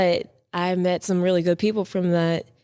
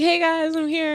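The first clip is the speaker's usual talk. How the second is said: cheery arrival; 9 semitones higher than usual. Retold or imitated. Guest retelling arriving at the shoot, high cheery call. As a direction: announce yourself to the room.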